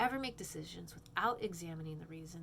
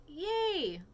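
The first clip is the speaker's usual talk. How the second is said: Peach cheering; 10 semitones higher than usual. Acted. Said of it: "Peach is like".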